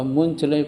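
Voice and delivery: "muncle" read as written, "moon-chlay"; spelling pronunciation. Shown as a demonstration. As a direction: sound out "muncle" as "mun-chlai". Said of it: "kalau tulisannya muncle".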